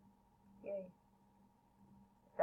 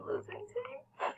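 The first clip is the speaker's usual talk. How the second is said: laughing; 16 dB quieter, slower than usual. Real, not acted. Laughs about losing team.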